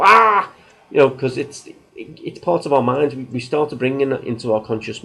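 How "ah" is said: much higher, much louder startled yelp; the shock of being caught out; startled cry. Retold or imitated. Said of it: "And they go".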